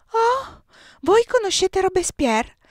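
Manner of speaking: startled whisper of surprise; blurted out in surprise. A narrator's reading. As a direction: let it slip out, amazed. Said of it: "mi scappò detto".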